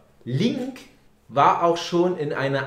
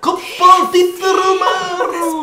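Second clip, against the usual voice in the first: mock Japanese-style names; 13 semitones higher, 8 dB louder than usual. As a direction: belt out the silly names like a stadium announcer. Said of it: "Wir heißen ja auch alle Franz. Für die Japaner".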